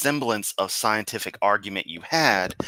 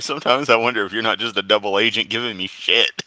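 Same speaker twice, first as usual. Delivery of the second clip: usual speaking voice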